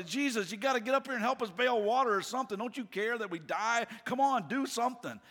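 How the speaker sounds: high-pitched, urgent pleading; panicked disciples waking someone up for help; freaking out. Acted. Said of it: "they're freaking out".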